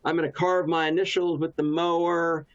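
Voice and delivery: put-on high, eager kid pitch to dad; eager son. Son pitching a mowing plan to dad, high and eager. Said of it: "So I would say".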